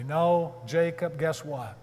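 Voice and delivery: normal talking voice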